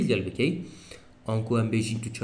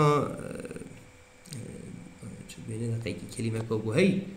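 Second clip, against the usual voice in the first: usual speaking voice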